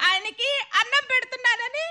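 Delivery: ordinary talk, nothing marked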